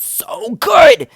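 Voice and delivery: very high, loud, slow rave; drawn-out, high-pitched burst of enthusiasm; gushing yell. Real, not acted. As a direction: stretch it out into an ecstatic shout. Gushing comparison with old workplace.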